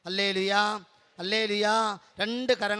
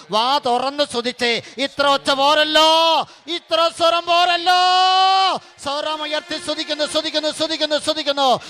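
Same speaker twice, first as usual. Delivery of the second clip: high, loud fiery preacher's call; preaching at full force; 7 semitones higher, 9 dB louder than usual. Acted. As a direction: whip the crowd into shouting praise. Preacher urges loud praise.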